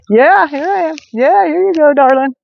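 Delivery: high, sing-song voice for the horse; high cooing pet talk. Real, not acted. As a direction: coo up high to a horse you love. Talking sweetly to the horse.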